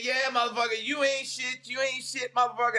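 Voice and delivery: high-pitched mimicked jeering; mock someone crowing insults over you; retold taunting. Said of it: "And you start laughing, say".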